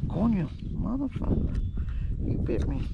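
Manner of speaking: ordinary talk, nothing marked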